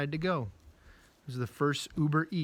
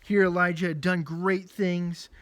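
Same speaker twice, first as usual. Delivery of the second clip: usual speaking voice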